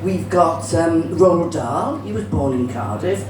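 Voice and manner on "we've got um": normal talking voice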